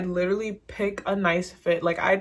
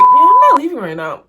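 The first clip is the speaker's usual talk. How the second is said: high, loud retold refusal; mock exclamation; 9 semitones higher, 13 dB louder than usual. Crush admitting he is staying, loud high mock exclamation. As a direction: imitate him, pitched up and loud.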